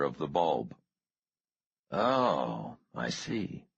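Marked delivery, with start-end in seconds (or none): 1.9-3.6 tired. A narrator's reading. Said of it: "Trask said tiredly".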